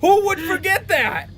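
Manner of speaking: high, loud excited agreement. Real, not acted. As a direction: burst out, thrilled by the idea.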